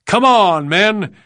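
loud, high-pitched battle cry; rallying yell. A narrator's reading. Lieutenant leading his men down the ramp, yelling. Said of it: "he yelled".

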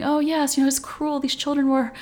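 hint of a fretting mother; overprotective mom. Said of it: "a little overprotective mom vibes".

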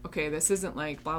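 ordinary talk

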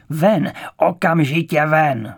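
loud, slow, furious order; frenzied yell. A narrator's reading. Director ordering Žak out the door, furious yell. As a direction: yell to throw someone out. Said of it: "zařval nepříčetně a ukázal na dveře".

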